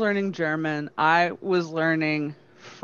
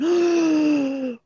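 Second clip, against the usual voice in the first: mock gasp; 10 semitones higher than usual. Class catches teacher swearing.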